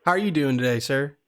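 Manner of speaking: put-on Southern accent. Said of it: "we're doing the Southern accent".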